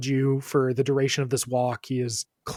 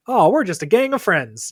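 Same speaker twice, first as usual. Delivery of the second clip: higher, louder mock-innocent quote; innocent gang member; 6 semitones higher, 5 dB louder than usual. Gang describing itself as just friends, lifted and louder. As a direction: lightly play it down in a lifted voice. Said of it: "in the, like".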